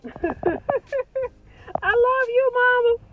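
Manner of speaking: high and loud, laughing through the words; laughing love. Real, not acted. Daughter laughing out love to her mother, high and loud. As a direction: squeal it out with a laugh.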